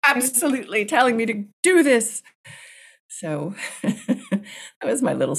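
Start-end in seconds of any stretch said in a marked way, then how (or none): 0.1-2.2 loud, high mock-indignant denial; insistent protest. Writer silently rejecting the librarian's motion-sensor explanation, insistent protest. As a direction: refuse the explanation emphatically.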